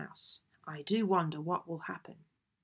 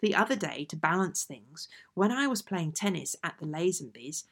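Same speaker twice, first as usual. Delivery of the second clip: plain reading voice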